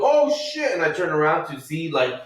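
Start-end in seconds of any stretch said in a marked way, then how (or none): 0.0-0.7 squeaky shocked yelp, much higher; startled oh shit. Retold or imitated. Turning the corner on the men, high startled yelp. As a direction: jump back with a high yelp. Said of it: "So I go".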